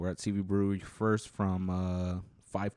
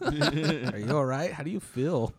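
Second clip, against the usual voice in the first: mock concern; 6 semitones higher, 5 dB louder, slower than usual.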